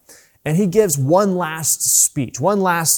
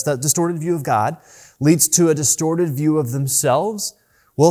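ordinary talk, nothing marked

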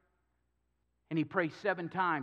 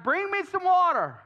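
much higher, louder commanding call; loud demand; 13 semitones higher, 8 dB louder than usual. Acted. Elijah calling to the people for water, high and loud. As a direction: bark it out like a prophet demanding.

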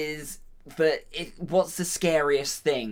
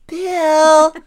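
high, loud call of "Bill"; 12 dB louder than usual. Pretend. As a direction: call Bill's name across a room. Calling the character's name.

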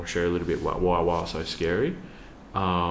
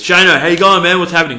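loud, high-pitched hearty hail; booming, excited impression of a friend; high-energy greeting; 11 semitones higher, 17 dB louder than usual. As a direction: imitate an exuberant mate calling out hello. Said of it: "Got a lot of energy".

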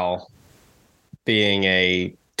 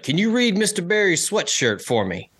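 mocking coach; 9 semitones higher than usual.

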